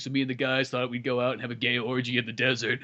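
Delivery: rushed then low and soft; mock main-guy voice. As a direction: lightly imitate the hero in a subdued murmur. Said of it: "Yeah, he's kinda like".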